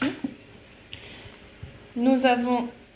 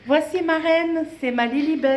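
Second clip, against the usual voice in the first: doting pet voice; 6 semitones higher than usual. Real, not acted. Showing her cat to viewers.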